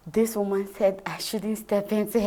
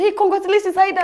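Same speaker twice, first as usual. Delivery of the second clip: cheering congratulations; 11 semitones higher, 10 dB louder, faster than usual. Retold or imitated. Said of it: "They say".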